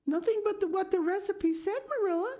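put-on high, pleading girl's voice; anguished girl. A narrator's reading. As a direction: defend herself in anguish. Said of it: "cried Anne with a look of anguish".

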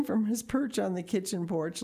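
ordinary delivery, nothing marked

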